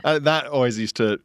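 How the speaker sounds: usual speaking voice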